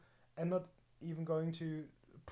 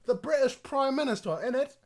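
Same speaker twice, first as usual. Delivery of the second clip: loud, high unposh English accent; T-less English accent; 9 semitones higher, 9 dB louder than usual. Shown as a demonstration. Demonstrating dropped T's, mock informal English accent. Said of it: "You cannot pronounce the T's".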